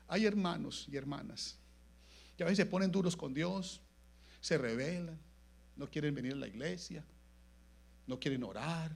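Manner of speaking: usual speaking voice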